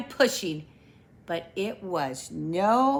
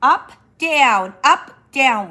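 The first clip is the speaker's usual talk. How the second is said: exercise count; 4 semitones higher, 8 dB louder than usual. A narrator's reading.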